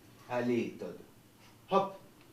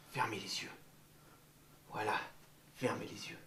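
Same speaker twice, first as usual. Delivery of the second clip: hushed, lower, gentle; soft whisper; 7 semitones lower, 13 dB quieter, faster than usual. Real, not acted. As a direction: whisper a calm instruction. Teacher guiding eyes closed.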